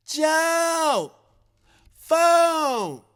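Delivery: high, long-held searing call; shrill annoying yell. Acted. Rita calling Joe to the phone from the kitchen, shrill high yell. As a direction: holler it high and stretch each word. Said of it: "shrill, searing, sonically fluid, annoying tone".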